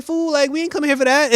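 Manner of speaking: high, mocking disbelief; incredulous put-down. The team scoffing at Cyborg's plan, high and incredulous. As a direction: scoff at a foolish idea. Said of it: "everybody was looking at him like".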